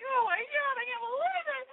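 very high, squealed mock wail; hammed-up horror. Tourist reacting to the driver's warning, hammed-up horror. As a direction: imitate a panicked, tearful squeal. Acting out her horrified reaction.

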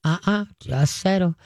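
calm, even "Uh-uh, just settle" to a jumping dog; calm low correction. Shown as a demonstration. Trainer calmly settling an excited dog, low and even. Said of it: "Just stay very calm".

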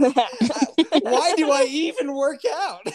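high, laughing through the words; real laughing. Real, not acted. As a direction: crack up in mock despair. Laughing at own exertion.

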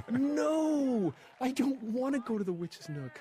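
high, drawn-out wail of protest; mock panicked refusal. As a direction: beg off like a dragged kid. Acts out panicked refusal to friends.

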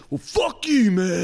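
high, very loud mock outburst; mad. Scammer refused easy cash turns on his helper, mad shout. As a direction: imitate a scammer blowing up in anger. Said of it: "It's crazy how mad they get".

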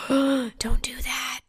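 sharp gasp, then a slow hushed warning; a horrified whisper, dragged out slowly; whispered alarm at a risky choice. Real, not acted. Host gasps at listener's second tab.

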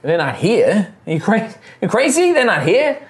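high, incredulous halfling; halfling guide. Acted. Halfling says family heads aren't here, high incredulous voice. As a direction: scoff it in a high, halting voice.

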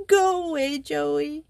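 high, tearful plea to be left alone; sobbing. Acted. Simone sobs at Joey to go away. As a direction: push her friend off through tears. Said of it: "sobbed Simone in her own language".

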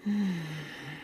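long, soft exhale; demonstrated calming breath; deep breath sigh. Shown as a demonstration. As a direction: breathe out slowly to calm down. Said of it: "take a deep breath".

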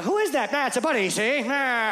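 a hint of a fast-talking forties gangster; 1940s movie actor. Texting the wrong person, caught, answering in a mild forties movie voice. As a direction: lightly play an old-movie tough guy, see. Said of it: "I sound like an actor from the nineteen forties".

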